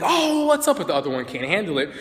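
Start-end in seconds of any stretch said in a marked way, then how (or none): 0.0-0.8 much higher, shrieking hype hello; over-the-top greeting. Shown as a demonstration. Trainer showing the wrong way to greet a dog, overexcited. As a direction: blow the roof off with excitement. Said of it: "Don't go through the roof like".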